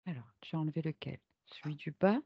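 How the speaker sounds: quick hushed whisper. Real, not acted. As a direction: whisper the question. Soigneur demande doucement. (chuchoté rapidement)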